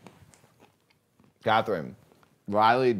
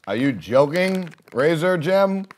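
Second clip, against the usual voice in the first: higher, louder disbelieving question; incredulous; 7 semitones higher, 8 dB louder, slower than usual. Real, not acted. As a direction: ask in loud disbelief. Incredulous at chat.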